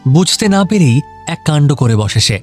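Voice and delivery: usual speaking voice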